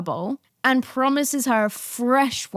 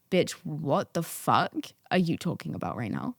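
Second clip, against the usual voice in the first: deadpan disbelief; 6 semitones lower, 7 dB quieter than usual. Retold or imitated. Acted deadpan reply to waiter.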